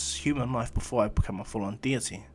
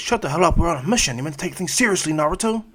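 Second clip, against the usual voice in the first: louder, higher annoyed snap; angry retort; 4 semitones higher, 6 dB louder than usual. Acted. Sasuke yelling at Naruto during the mission, angry. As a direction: bite back at a smug teammate. Acted quarrel, Sasuke snaps back.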